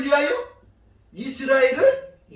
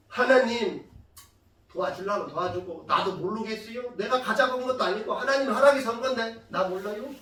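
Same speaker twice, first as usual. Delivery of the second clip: indifferent prayer. Pretend. Moses imagined praying as if not responsible, indifferent prayer. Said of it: "이렇게 기도했겠습니까?".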